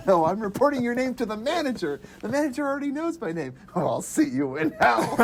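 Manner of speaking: high, mock-indignant back-and-forth; quoted argument. Customer and Ed arguing, high mock-indignant. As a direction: trade insults playfully. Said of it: "customer argument quoted".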